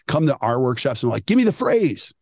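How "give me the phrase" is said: higher-pitched impatient demand; mock demanding. Workshop attendee wanting a quick script, high mock demand. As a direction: mimic an eager attendee, pitched up.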